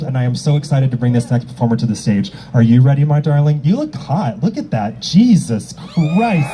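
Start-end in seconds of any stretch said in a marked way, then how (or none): 5.0-6.5 slow drawn-out "Jesus Christ"; stunned exclamation. Real, not acted. Host gasping at the performer's outfit, drawn out. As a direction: stretch it out, amazed.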